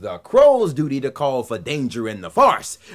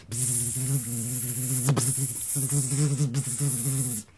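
buzzy housefly; 7 dB quieter, much slower than usual. Acted. Mosquito buzzing sound.

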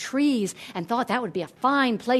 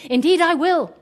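higher, louder, hearty promise; eager agreement; 7 semitones higher, 8 dB louder than usual. A narrator's reading. Hero eagerly agrees to help.

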